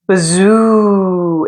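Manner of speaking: long eerie wind whoosh; howling wind sound. A narrator's reading. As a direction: blow it out like a moaning wind. Wind sound effect in story.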